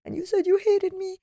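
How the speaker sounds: mock indignant mother; indignant mom. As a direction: mom confronting her daughter, hammed-up indignation. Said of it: "I said".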